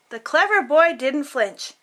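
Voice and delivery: ordinary delivery, nothing marked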